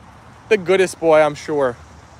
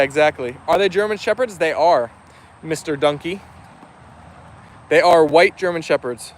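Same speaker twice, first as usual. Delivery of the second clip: ordinary talk, nothing marked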